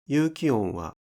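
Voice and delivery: ordinary talk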